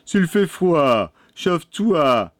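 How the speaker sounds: put-on moderately high, slow boy's voice; boy's retort. A narrator's reading. Youngest brother answers the witch.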